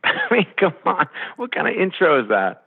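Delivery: high-pitched, amused objection; playful exasperation; mock indignant protest. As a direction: protest it upward, half laughing. Retold protest at a bad introduction.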